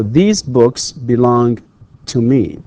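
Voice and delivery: slow model sentence. Shown as a demonstration. Teacher showing students the right answer, slow and clear. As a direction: read it slowly with weight on these. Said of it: "we have to use these".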